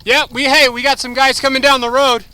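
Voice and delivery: loud call to teammates. Real, not acted. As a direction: call it out across the field. Calling out to teammates mid-game.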